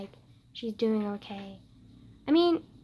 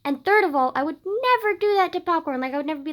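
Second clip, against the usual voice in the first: loud, high-pitched protest; vehement, indignant insistence; 7 semitones higher, 8 dB louder, faster than usual. Real, not acted. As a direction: punch "never" in outraged denial. Indignant at own dream behaviour.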